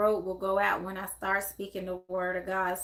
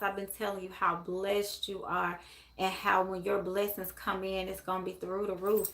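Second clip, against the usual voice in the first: normal talking voice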